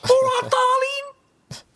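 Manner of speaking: very high, drawn-out caricature; exaggerated high, slow put-on voice; Justin. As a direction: stretch it out in a squeaky mocking impression. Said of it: "that's how Justin speaks".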